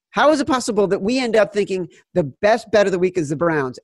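ordinary talk, nothing marked

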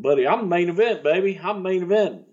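cocky main-event brag; bragging promo. Retold or imitated. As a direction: crow it like a heel. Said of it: "tore everybody up".

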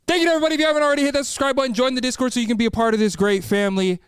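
high, upbeat sign-off; outro hype. Acted. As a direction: pump up the outro call to action.

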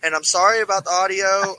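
sounds like a tin can on a string; thin metallic phone-line sound; tinny bad audio. Real, not acted. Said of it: "I sound like a tin can with a string on it".

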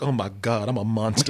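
low, soft horrified murmur; ashamed whisper. Retold or imitated. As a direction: say it with quiet shame. Ashamed realization.

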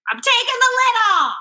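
high, loud, triumphant cry; loud and defiant. Retold or imitated. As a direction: belt it out defiantly. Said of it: "I was like".

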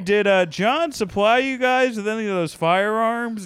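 high, loud mock reporter. Reporter asking at press conference.

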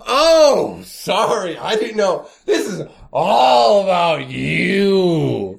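slow, whiny mock-hurt sarcasm. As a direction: whine it like you're wounded.

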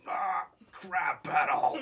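huge drawn-out mock yell; imitated battle cry. Retelling the man heard down the radio, drawn-out battle yell. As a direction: bellow it slow and high, breaking into laughter. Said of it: "all you can hear down the radio is him going".

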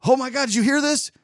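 high excited call, mildly imitated; mock ex-girlfriend calling upstairs with news; a light imitation of an excited shout; retold yell up the stairs. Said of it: "was yelling up the stairs".

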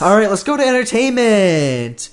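loud, high segment launch; hyped-up announcement. Pretend. Co-host launches the entertainment segment, hyped-up announcement. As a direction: belt out the segment name like a show host.

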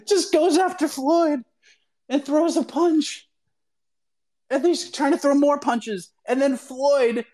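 telling it like it just blew up in front of you; excited. Real, not acted. Retells the fight breaking out.

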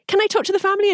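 high-pitched imitation of an eager ask; eager request. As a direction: act out asking hopefully, voice shooting up. Retells own eager question to director.

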